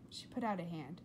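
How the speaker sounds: usual speaking voice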